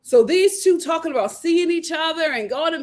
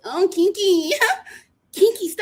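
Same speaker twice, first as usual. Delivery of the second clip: mock giggling; 7 semitones higher than usual. Vlogger mocking her giggling flirt, laughing and high. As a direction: giggle out Kinky stop. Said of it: "she's like".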